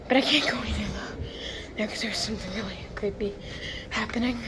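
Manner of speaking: hushed nervous whisper; whisper, creeped out; nervous night vlog whisper. Real, not acted.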